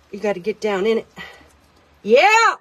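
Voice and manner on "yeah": sudden high, loud burst of triumph; real excited yell, much louder; excited shout. Real, not acted. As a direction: cheer loudly as it finally works. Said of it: "sorry, that was loud. That wor-, I just got excited".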